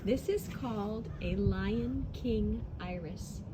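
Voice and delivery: ordinary talk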